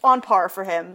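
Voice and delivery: ordinary delivery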